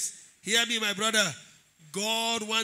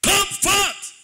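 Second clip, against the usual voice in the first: high, loud, thundering command; commanding shout; 11 semitones higher, 7 dB louder than usual. Retold or imitated. Jesus calling Lazarus out of the grave, commanding shout. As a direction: call the dead out with a shout. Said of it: "he shouted".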